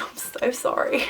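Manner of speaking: sheepish whisper, voice dropped; whispered apology. Real, not acted. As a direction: whisper sorry, a little embarrassed. Apologising after spitting on camera.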